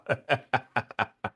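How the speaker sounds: helpless run of chuckles; long real laugh. Real, not acted. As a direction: keep laughing at the joke. Amused by a chat joke.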